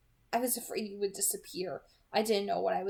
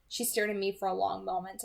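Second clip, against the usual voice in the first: plain reading voice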